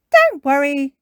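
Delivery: high, loud breezy reassurance; Miss Rabbit. Acted. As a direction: toss it out in a high voice. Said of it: "says Miss Rabbit".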